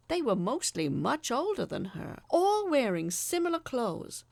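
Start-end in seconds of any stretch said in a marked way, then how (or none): none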